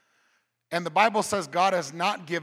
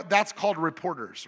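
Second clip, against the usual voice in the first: usual speaking voice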